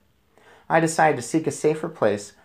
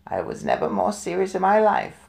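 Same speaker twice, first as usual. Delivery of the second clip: grandmother; 6 semitones higher than usual. A narrator's reading. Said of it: "my grandmother said".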